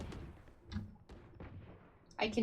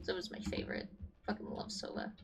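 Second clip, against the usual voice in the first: hushed whisper; 3 semitones lower, 15 dB quieter, faster than usual. Real, not acted. Muttering fan talk.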